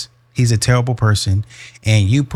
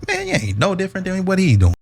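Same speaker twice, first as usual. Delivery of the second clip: exasperated scolding; 9 semitones higher than usual. Acted. Scolding woman, voice pitched up.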